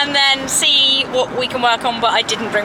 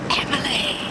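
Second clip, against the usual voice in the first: soft breathy whisper to the pony; whispering the pony's name; 10 dB quieter than usual. Real, not acted. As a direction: hush it as if not to startle her.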